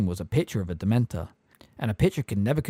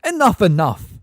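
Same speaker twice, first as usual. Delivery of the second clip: dismissive; 8 semitones higher, 9 dB louder than usual. A narrator's reading. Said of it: "superstitious look".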